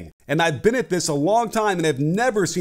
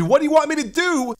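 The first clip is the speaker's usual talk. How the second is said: imitating a frustrated player pleading; exasperated; 7 semitones higher than usual. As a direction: throw up your hands in a voiced plea. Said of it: "We get an exasperated".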